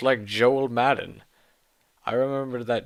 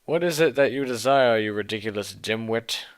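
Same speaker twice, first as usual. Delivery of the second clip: usual speaking voice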